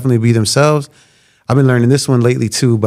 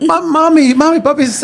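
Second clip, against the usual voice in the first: imitating little daughters. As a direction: squeal like an excited little girl. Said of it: "They're like".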